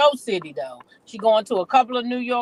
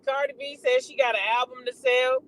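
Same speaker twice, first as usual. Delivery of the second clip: ordinary delivery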